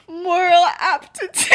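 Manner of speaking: loud, drawn-out line through laughter; cracking up. Acted. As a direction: play him cracking up as he says it. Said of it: "he's just cracking up".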